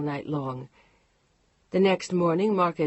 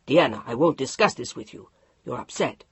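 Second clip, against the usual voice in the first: low, firm, unbending; a hint of a steely husband; 5 semitones lower, 3 dB louder than usual. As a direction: state it as final law. Mark dismissing her.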